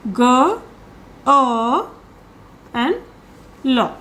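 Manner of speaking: put-on "girl" split into g, long vowel, l, with pauses; sounded out in parts. Shown as a demonstration. Teacher explaining the missing symbol, "girl" sound by sound. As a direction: break "girl" into its separate sounds, R left out. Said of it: "this symbol for this, like".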